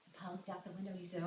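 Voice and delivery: ordinary delivery, nothing marked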